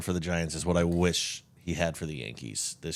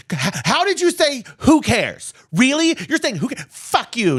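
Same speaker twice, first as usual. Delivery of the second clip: high indignant squeal, much higher and louder; outraged Swiftie; 4 dB louder than usual. Retold or imitated. Retelling a Swiftie yelling at a coworker, high shrill. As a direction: act out the shrieking fan. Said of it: "And she's like".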